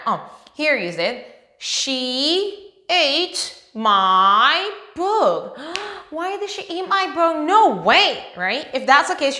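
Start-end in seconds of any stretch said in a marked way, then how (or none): 1.6-5.6 very slow. Shown as a demonstration. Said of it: "pattern sentence read for kids". 5.8-7.5 mock shock. Shown as a demonstration. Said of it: "mock shock at the sentence".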